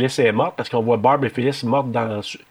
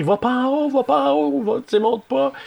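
much higher, frantic warning cry; retold panicked yell; 14 semitones higher than usual. Retelling the sergeant shouting at Jess, high panicked yell. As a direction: yell up at her not to go upstairs. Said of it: "il crie".

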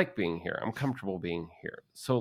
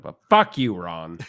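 loud; 10 dB louder, slower than usual. Real, not acted. Cursing out boss Ron.

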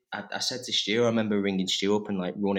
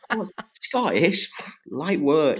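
high, louder mock boast; cocky bluff; 8 semitones higher, 5 dB louder than usual. Actor retelling how he claimed the accent, mock cocky. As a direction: toss it off with exaggerated confidence.

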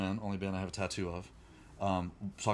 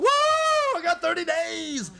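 very high, very loud whoop, slowing on "I got thirty days"; ecstatic shout; 11 dB louder, much slower than usual. Retold or imitated. Sober addict bragging to the mental health facility, ecstatic shout. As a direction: yell it out like you just won big. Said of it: "Sorry if that was loud in there".

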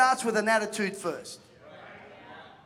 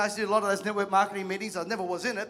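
usual speaking voice

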